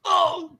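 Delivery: loud, high cry of impact; mock groan of a man crumpling; acted pained "oh". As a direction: cry out as if struck. Game master acts guy being hit.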